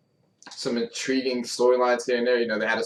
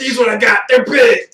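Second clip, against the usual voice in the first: shouted, high muscle-head boast; Scott Steiner impression; 12 semitones higher, 11 dB louder than usual. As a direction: bellow like a roided wrestler.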